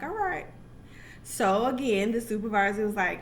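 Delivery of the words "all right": high, sarcastic all right; a sceptical brush-off; unimpressed all right. Retold or imitated. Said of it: "looking at her like".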